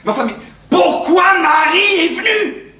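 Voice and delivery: high, slow lamenting cry; exasperated outburst. Acted. Brother bemoaning the unexpected wife, exasperated cry.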